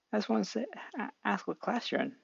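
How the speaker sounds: shy Izuku. Acted. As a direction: ask timidly. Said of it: "Izuku talking to Kopen".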